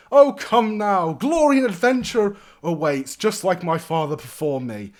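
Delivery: high, loud, grand protest; exuberant young lord. Acted. Young lord dreams of glory and adventure, exuberant. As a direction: sweep the objection aside heroically.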